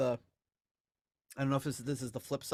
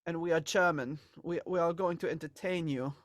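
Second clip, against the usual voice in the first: hammed-up German accent, higher pitch; terrible German accent; 4 semitones higher than usual. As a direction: put on a bad, playful German accent. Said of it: "That's my German accent for you".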